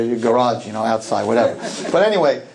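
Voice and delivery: usual speaking voice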